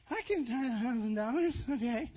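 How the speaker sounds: tentatively agree, softly; hesitant tither. Acted.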